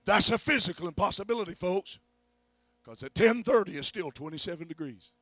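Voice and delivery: high, loud preacher's cry; preaching at full force. Real, not acted. Preacher to the folks, declaring what God did, loud and high. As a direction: hammer home the miracle.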